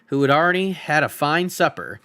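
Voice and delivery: ordinary delivery, nothing marked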